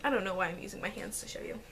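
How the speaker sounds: fast, hushed whisper to herself; whispered aside. Real, not acted. Vlogger to viewers about her own hands, whispered aside. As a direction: breathe it out quickly under your breath.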